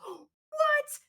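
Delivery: very high squeaky what; shocked shriek. Retold or imitated. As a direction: shriek in disbelief.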